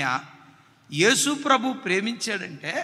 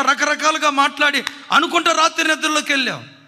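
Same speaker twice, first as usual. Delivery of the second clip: rising sermon fervour, urgent; తీవ్రంగా బోధిస్తూ; preaching at full force; 4 semitones higher, 4 dB louder than usual. Acted. Preacher rebukes husbands.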